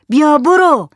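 high, loud angry dismissal; angry shout. Acted. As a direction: shout them away angrily. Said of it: "با عصبانیت داد زد". (داد زدن با عصبانیت)

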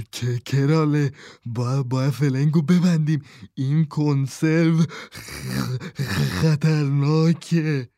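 scared stutter, slowing down at the end; stammering. A narrator's reading. Eddie stammering to Krale as the robot closes in. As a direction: trip over the words in fright. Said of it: "تته پته کنان گفت".

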